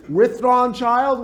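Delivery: ordinary delivery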